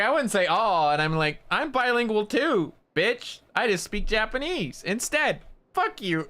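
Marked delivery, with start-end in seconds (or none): 0.0-0.9 sassy retort. Retold or imitated. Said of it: "And I was like". 1.5-6.3 high, hammed-up mock outrage; mock offended. Imagined comeback to the Canadian guy in the elevator, high mock outrage. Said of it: "That's what I wanted to say".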